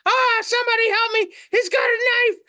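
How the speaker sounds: very high panicked cartoon shriek; screaming. Pumpkin being carved by a guy, high terrified screaming. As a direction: squeal for help in comic terror. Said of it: "the pumpkin starts screaming".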